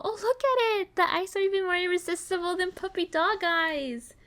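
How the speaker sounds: high-pitched adoring coo. Acted. Looking at the adorable teacup pig, cooing. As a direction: gush high over something tiny and cute.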